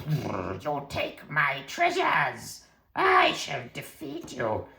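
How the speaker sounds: slow, drawn-out monster voice; wizard troll. Acted. Troll threatening thieves over his treasures, slow and menacing. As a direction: growl in, then intone the threat slowly.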